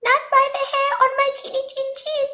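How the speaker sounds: very high, quick squeaky piggy voice; squealing little pig. A narrator's reading. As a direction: squeal it fast like a tiny pig. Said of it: "squealed the little pig".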